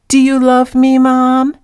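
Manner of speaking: high, loud put-on cat voice asking; imitating the cat. Cat owner voicing her cat asking for love, high loud mock cat voice. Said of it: "He says".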